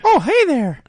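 piercing cartoon squeak, high; Mickey Mouse voice. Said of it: "I love that voice".

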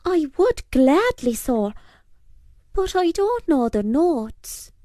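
put-on high-pitched boy's voice; piper boy. A narrator's reading. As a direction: answer in a high, apologetic boy's voice. Piper boy answers the púca.

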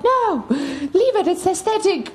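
high, drawn-out playful whine of refusal; mock protest. Child refusing mom's request to put a light on, mock protest.

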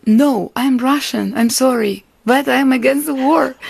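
insist, then apologise, then hold firm; earnest insistence. Retold or imitated. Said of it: "I said".

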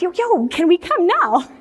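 high, keen request; over-eager. Retold or imitated. Mother eagerly pressing for immediate visit.

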